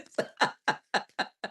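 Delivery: long, breathy run of laughter; helpless amused laughing; real laugh. Real, not acted. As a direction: crack up at the reply. Amused by chat replies.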